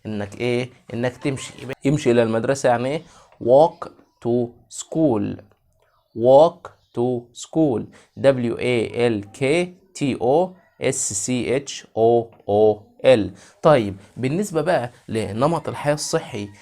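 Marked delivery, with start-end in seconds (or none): 3.4-7.8 Walk to school stretched very slow, WALK stressed; slow drilled model. Shown as a demonstration. Teacher modelling a sentence for kids, very slow and clear. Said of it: "repeat drill". 8.2-13.3 each letter said slowly and separately; slow spelling. Shown as a demonstration. Teacher spelling a phrase for kids, slow letters. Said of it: "spelling letters".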